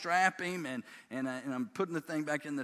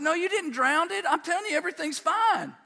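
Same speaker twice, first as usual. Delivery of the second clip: high, loud panicky-dad retelling; insistent reassurance; 13 semitones higher, 7 dB louder than usual. Retold or imitated. Dad to crying son after the pool dunking, acted urgent reassurance. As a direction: insist over the child's crying, pitched way up.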